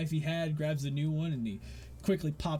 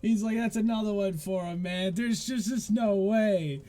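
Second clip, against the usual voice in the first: excited cheer; 7 semitones higher, 6 dB louder than usual. Acted. Dart patron cheers the winning thrower, loud and excited. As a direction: whoop it up for the dart champ.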